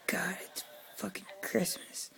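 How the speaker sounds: soft mock whisper of holiday grumbling; whispered complaint. Imitating people who whisper complaints about Christmas. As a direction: whisper it like a jaded grump. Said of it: "I've heard people be like".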